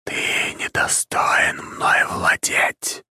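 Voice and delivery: slow, whispered spirit rebuke; ghostly drawn-out whisper; otherworldly whisper. A narrator's reading. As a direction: whisper it slowly like a voice in the head. Disembodied familiar rebukes the hero.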